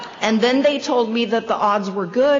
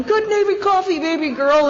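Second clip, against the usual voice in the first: much higher, put-on voice; mock gushing member; 6 semitones higher than usual. Old-timer thanking her for strong coffee, high gushing voice. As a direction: gush it like a buzzing old-timer.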